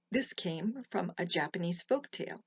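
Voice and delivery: plain reading voice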